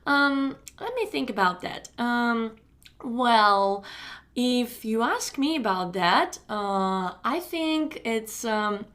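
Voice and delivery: slow, drawn-out filler words; hesitant stalling. Shown as a demonstration. Teacher demonstrating how natives stall for time, hesitant. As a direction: stall for thinking time with long ums. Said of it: "I can waste a lot of time just thinking".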